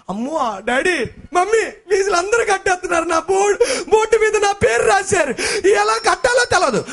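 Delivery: put-on high, tearful child begging; a crying kid pleading with mom and dad. Child begging parent for fees.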